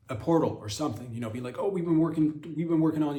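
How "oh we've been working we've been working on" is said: proud Iran voice. Retold or imitated. Voicing Iran presenting a gift.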